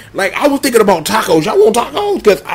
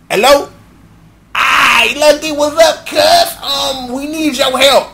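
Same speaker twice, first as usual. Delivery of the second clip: phone call shout; 5 semitones higher, slower than usual. Acted. Greeting Lucky on the phone, raised and higher. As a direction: call out over a bad line.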